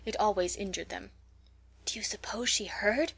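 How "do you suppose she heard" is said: furtive unvoiced whisper. A narrator's reading. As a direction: whisper it secretively to a friend. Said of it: "whispered Mary Silver".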